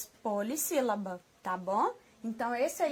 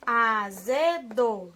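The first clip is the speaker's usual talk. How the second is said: "Azedo" very slow and loud, in three beats; separando as sílabas; 5 dB louder, much slower than usual. Shown as a demonstration. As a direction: stretch "Azedo" out as a-ze-do, loudly. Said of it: "Quantas vezes nós abrimos a boquinha". ("Azedo" forte e bem lento, sílaba por sílaba)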